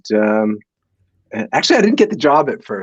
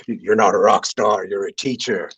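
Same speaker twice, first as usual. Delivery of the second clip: voice from the sky; 5 semitones lower than usual. Retold or imitated. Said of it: "the voice from the sky says".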